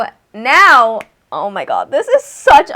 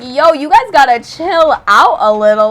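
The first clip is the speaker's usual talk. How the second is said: louder, emphatic retold protest; mock telling-off; 3 semitones higher, 6 dB louder than usual. Telling impatient partygoers to chill out, exasperated retelling. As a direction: tell the crowd off in playful exasperation.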